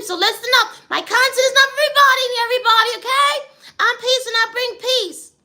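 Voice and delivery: loud, high, rousing street-sermon delivery; fired-up preaching. Acted. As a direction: preach it at the neighbor, building to a climax. Pep talk to neighbor.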